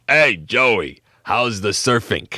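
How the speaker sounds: put-on big gruff old neighbor, loud and drawn out; gruff, loud porch greeting; Mister Giammalvo. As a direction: call out in a big rough Sicilian-Brooklyn voice. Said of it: "gruff voice, talk like this".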